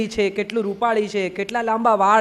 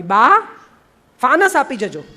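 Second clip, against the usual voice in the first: loud, raised call; 3 semitones higher, 6 dB louder, slower than usual. Acted. Gandhi calls Ba to bring a lantern, calling out. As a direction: call to someone in another room. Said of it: "એમણે બૂમ પાડી".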